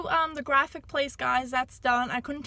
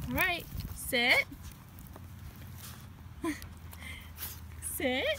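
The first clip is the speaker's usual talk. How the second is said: ordinary delivery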